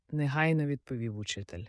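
ordinary delivery, nothing marked

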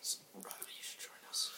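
hushed, secretive whisper; conspiratorial whisper. Acted. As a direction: whisper it to an accomplice. Conspiring aside to a fellow player.

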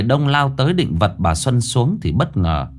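plain reading voice

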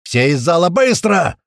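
loud, high shouted order; full-throated yell. A narrator's reading. Narrator clearing the hall at the alarm, yelling. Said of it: "заорал я во всё горло".

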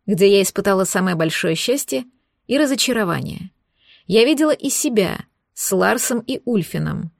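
ordinary delivery, nothing marked

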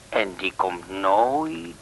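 slow, hushed and spooky, drawing out nooit; ominous slow telling. Acted. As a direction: tell it like a ghost story, very slowly. Ominous tale of vanishing man. (langzaam en onheilspellend, met nadruk op nooit)

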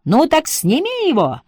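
high-pitched yell, lightly done; mock yell. A narrator's reading. Emma answers Lottie downstairs about the kettle, mild yell. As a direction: yell back high without much volume. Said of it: "заорала Эмма".

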